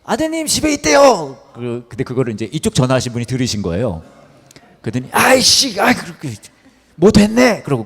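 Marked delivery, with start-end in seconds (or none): 0.0-1.4 high, loud shouted news; acted shout, drawn out at the end; urgent calling out. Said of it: "이분이 전화해 주신 분이". 5.1-7.6 loud, high burst of annoyance; acted exasperated outburst; mock frustrated cursing. Scammer caught, curses and hangs up.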